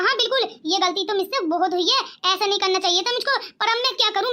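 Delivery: ordinary delivery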